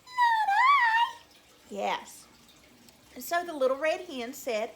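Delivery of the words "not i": very high, loud squeak; squeaky mouse. Shown as a demonstration. Storyteller showing children how a mouse would say it, high squeaky voice. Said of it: "how would a mouse say".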